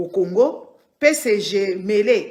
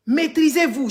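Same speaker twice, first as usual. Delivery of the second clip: stern scolding; 7 semitones higher than usual. Real, not acted. Scolding distracted chat viewers.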